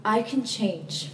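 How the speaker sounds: slow, loud "I can change"; firm declaration. Real, not acted. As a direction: land "I can change" slowly and firmly. Affirming sobriety.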